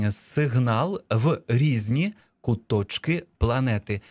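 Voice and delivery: measured, words set apart; slow dictation. Shown as a demonstration. Teacher reading the dictation text back, slow and measured. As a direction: read it out for people writing along. Dictation phrase read out slowly.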